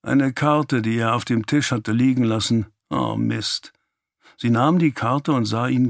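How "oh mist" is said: low, sinking "caught out" thought; dismayed muttered aside. A narrator's reading. Boy's dismayed thought in narration. (bestürzt, tief gemurmelt)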